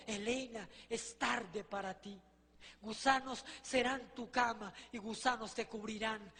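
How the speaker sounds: low, hushed, slow pronouncement; solemn voice of Jesus. Retold or imitated. Jesus refusing a condemned woman's plea, solemn and quiet. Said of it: "Jesús le dijo algo terrible".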